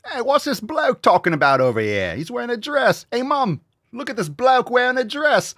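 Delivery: put-on high, childish British toddler calling out; British toddler to Mum, mocking. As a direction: tug at Mum and point. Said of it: "the kid's like".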